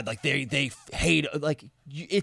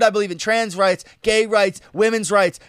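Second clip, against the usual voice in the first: emphatic quote; 6 semitones higher, 5 dB louder than usual. Retold or imitated. Quoting Sanjay's list.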